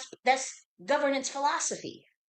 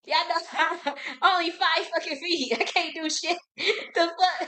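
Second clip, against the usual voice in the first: higher, laughing while talking; laughing through words; 6 semitones higher than usual. Real, not acted. Laughing at herself, a tiny woman against them all. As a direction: giggle through each line in disbelief.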